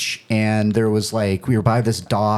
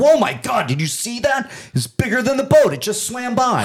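mock alarmed crowd; 12 semitones higher than usual. People on the boat pranking a kid, high fake alarm. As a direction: sell the prank with wide-eyed alarm. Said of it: "everybody's like".

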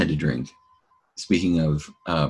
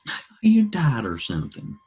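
mock worried neighbor; 9 semitones higher than usual. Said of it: "It's like".

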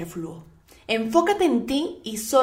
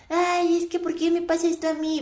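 whiny victim voice; 6 semitones higher than usual. Retold or imitated. Mocking someone who plays the victim.

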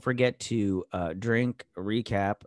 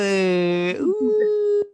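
high squeal; 8 dB louder than usual. Acted. Devil power squeal.

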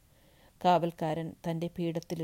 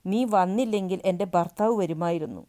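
loud, raised blaming cry; bitter accusation; 3 semitones higher, 8 dB louder than usual. Acted. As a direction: hurl the blame at him. Widow blames the boy.

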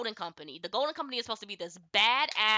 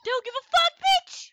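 laughing shout. Real, not acted.

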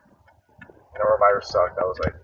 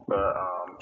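ordinary talk

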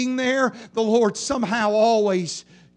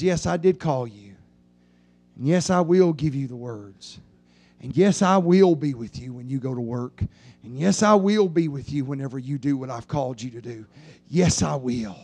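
much lower, calm, steady; reassuring voice of God; 10 semitones lower than usual. Acted. The Lord reassuring the preacher, low and calm. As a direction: speak as the Lord, deep and reassuring.

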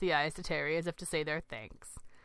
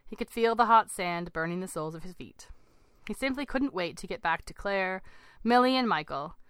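usual speaking voice

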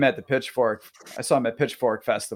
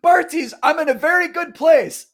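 high, loud shout to the stage; playful re-enacted concert holler; mock fan yell; 8 dB louder than usual. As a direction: call it out like an earnest fanboy, joking. Redoes his yell with a preface.

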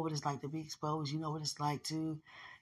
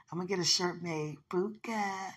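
normal talking voice

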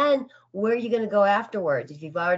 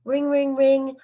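phone ringing; 7 semitones higher, 4 dB louder, slower than usual. Pretend. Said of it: "I've just called you up".

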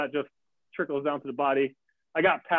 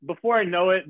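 usual speaking voice

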